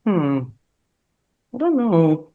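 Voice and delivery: ordinary delivery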